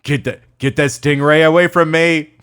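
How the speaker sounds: put-on loud, high Australian accent; Steve Irwin. Tasteless bit about the stingray accident, mock-alarmed Australian. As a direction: yelp it as a hammed-up Aussie wildlife host. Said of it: "or Steve Irwin".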